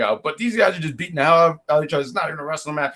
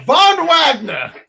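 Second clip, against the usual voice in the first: slow, high hype-man announcement; hyped announcer; 6 semitones higher, 4 dB louder, much slower than usual. Pretend. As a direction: draw out the name like a ring introduction. Hypes up favourite wrestler's name.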